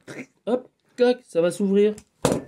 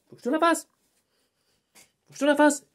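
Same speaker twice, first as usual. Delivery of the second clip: zouk creole vibe; 10 semitones higher than usual. Shown as a demonstration. Showing a friend how he sounded, lightly creole. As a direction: do the zouk persona, laid-back.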